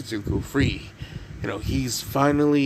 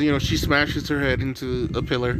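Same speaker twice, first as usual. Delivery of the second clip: usual speaking voice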